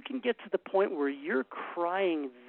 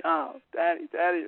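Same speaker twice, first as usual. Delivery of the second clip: put-on mild child cry; 3 dB louder than usual. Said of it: "They'd come screaming to me".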